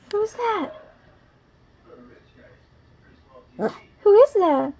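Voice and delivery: high, loud puppy calling. Real, not acted. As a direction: call the puppy in sing-song. Puppy at mirror.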